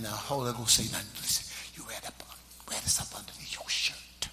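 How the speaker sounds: low, hushed whisper. Retold or imitated. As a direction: imitate a church mother's secret whisper. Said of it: "She was whispering".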